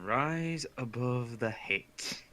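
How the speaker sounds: soft, slow murmur to himself. Acted. Hero murmurs to himself before entering, soft and slow. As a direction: say it under your breath, slowly. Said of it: "murmured to himself".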